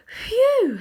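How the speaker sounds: high breath of relief; relieved phew. A narrator's reading. Polly breathing out in relief after the witch leaves. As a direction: let it all out. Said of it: "letting out a long breath of relief".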